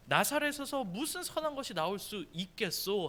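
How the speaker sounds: solemn Bible-verse delivery; formal scripture reading. Shown as a demonstration. Pastor reading Nathanael's reply to youth, formal reading. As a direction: read the archaic verse in a set-apart reading voice. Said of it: "나다나엘이 그에게 말하기를".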